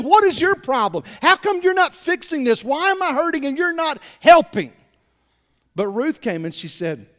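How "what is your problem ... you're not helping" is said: mock whiny complaint. Mock complaining church member.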